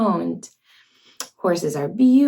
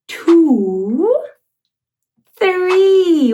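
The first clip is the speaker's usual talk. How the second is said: excited count; 5 semitones higher, 5 dB louder than usual. Shown as a demonstration. Counting doors to child.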